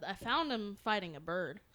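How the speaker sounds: ordinary talk, nothing marked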